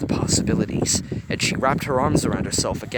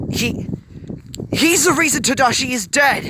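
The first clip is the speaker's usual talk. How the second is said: much higher, louder angry snarl; furious, far higher, loud snarl; 14 semitones higher, 7 dB louder than usual. A narrator's reading. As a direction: spit it out in fury. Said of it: "Hiro snarled".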